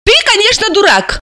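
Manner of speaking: high-pitched, matter-of-fact agreement; unruffled agreement. A narrator's reading. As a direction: agree coolly, without fuss. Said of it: "невозмутимо согласилась Алиса".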